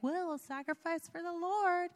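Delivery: mock your own naive piety; airy self-mockery. Mocking what she kept saying on the trip, airy singsong. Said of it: "I kept saying, like".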